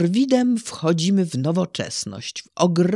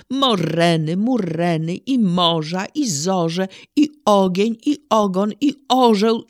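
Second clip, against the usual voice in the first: building, louder rhythmic verse reading; poem recitation; 3 dB louder than usual. Shown as a demonstration. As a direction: recite with growing wonder, beat by beat. Recites Szymborska's poem Urodziny.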